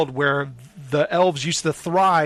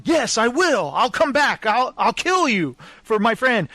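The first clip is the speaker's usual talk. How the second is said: higher, eager declaration, a touch heroic; bold hero vow; 7 semitones higher than usual. Retold or imitated. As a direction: swear it like a reckless little hero. Dandelion vows revenge for Geralt.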